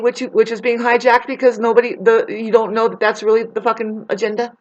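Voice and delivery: normal talking voice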